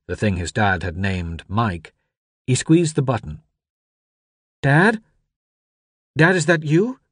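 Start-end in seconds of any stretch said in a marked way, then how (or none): 4.6-7.0 put-on high, louder, questioning boy's voice; anxious boy calling. A narrator's reading. Boy squeezes the button to reach Dad, anxious. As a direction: call hopefully into the radio for your father. Boy calls for father on radio.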